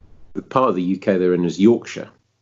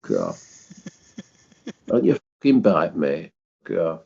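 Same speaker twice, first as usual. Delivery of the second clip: tough Yorkshireman. Pretend. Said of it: "pretend to be a Yorkshireman or a Yorkshire woman and say".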